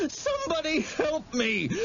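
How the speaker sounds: high, sobbing shout for help; cry for help. A narrator's reading. Terrified boy shouting for help as he runs, sobbing. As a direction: scream for help while fleeing.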